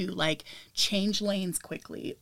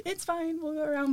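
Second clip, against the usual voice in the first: fake calm; 10 semitones higher, 3 dB quieter than usual. Feigning calm to boyfriend.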